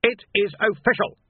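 curt captain's snap; snapped. A narrator's reading. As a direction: snap it at him like an officer. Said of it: "snapped Rust".

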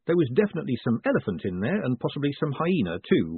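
ordinary delivery

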